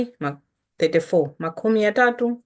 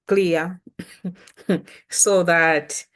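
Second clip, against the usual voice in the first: ordinary delivery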